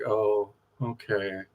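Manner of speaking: slow, soft and defeated; deflated give-up. Retold or imitated. Recruiter finally giving up on her, deflated and soft. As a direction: let the air out as he gives up. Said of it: "he finally had to give up".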